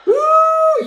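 very high, loud oh; surprised oh. Real, not acted. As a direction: squeal in surprise. Reacts to the duck's car. (تعجب حاد)

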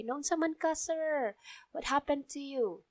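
caring doctor asks a nervous patient; soft pacifying tone. Shown as a demonstration. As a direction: ask gently to calm the patient. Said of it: "more like pacifying, a softer side".

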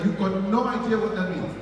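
normal talking voice

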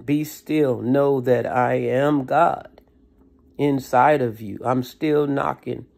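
voice of God. Retold or imitated. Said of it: "is what he said".

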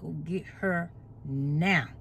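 low, slow and soft, every word weighted; emphatic God voice. Retold or imitated. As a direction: deliver God's command deep and slow. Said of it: "when he put emphasis on".

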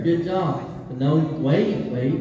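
ordinary talk, nothing marked

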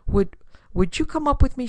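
halting, half-whispered, high-pitched ask; hesitant, slightly frightened plea; timid request. A narrator's reading. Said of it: "frightened look".

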